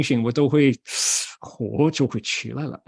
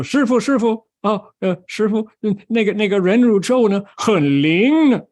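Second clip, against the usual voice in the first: high, stumbling excited report; excited disciple; 7 semitones higher, 4 dB louder than usual. Acted. Disciple tells master the mantra works, excited and high. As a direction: burst in, tripping over the news. Disciple runs in with good news.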